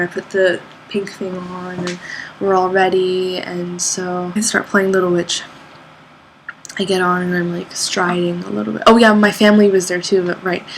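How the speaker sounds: ordinary talk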